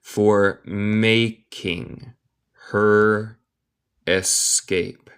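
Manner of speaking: very slow, word by word; teacher's slow, drawn-out model reading; slow dictation. Shown as a demonstration. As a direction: separate each word with a clear pause.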